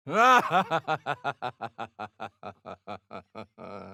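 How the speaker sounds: long laugh. Acted. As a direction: laugh at length, amused. Character laughs.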